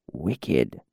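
low, soft whispered word; temple whisper. Acted. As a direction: breathe the word, low and hushed. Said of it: "whispered the priest".

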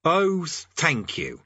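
slow, bitter sarcasm. Acted. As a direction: drag out the mock thanks. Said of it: "said Rincewind bitterly".

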